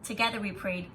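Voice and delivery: expressive read-aloud voice; story narration. A narrator's reading. Teacher reading a picture book to students, expressive. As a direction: read the story to the class, bringing each line to life.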